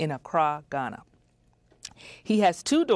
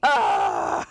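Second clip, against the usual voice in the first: mock cry; 4 dB louder than usual. Lament that host left.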